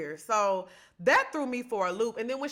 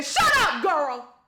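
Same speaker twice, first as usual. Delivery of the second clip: excited shout; 8 semitones higher, 4 dB louder, slower than usual. Retold or imitated. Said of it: "They said".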